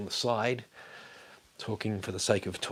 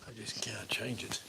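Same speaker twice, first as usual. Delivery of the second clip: hushed frustrated whisper; 10 dB quieter than usual. Real, not acted. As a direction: breathe it out under the breath. Frustrated aside to self.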